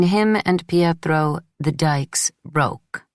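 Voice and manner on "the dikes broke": usual speaking voice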